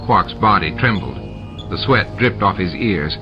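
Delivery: plain reading voice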